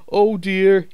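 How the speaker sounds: high, mournful sigh of an engine; sorrowful oh dear; a sad little engine sighing. A narrator's reading. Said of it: "he thought sadly".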